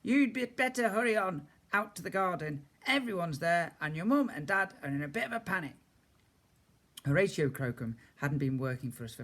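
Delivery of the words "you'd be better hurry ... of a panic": gardener. A narrator's reading. Gardener urging Frankie.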